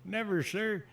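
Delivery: imitated recruit answer, high and loud; recruits in unison. Recruits answering the drill instructor together, mock high-pitched shout. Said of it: "So we're all like".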